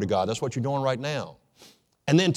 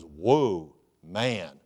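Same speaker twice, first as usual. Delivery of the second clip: awestruck Adam; 6 semitones lower than usual. Acted. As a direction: let "Whoa" drop low as Adam sees her. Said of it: "He goes".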